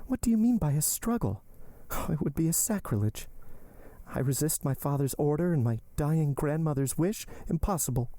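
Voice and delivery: high-pitched heroine, shocked protest; Valentine, shocked. Acted. Valentine refusing to defy her father, shocked. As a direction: pitch it high, dismayed at the very idea.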